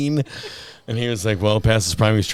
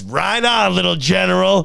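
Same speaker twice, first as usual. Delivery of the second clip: friendly cheer; 10 semitones higher, 3 dB louder than usual. Retold or imitated. Trooper waving him through.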